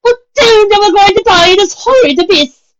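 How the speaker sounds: high, loud crying wail. A narrator's reading. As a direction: wail in theatrical despair. Rarity wails on.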